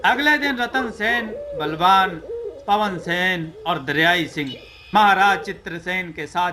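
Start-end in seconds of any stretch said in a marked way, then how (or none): none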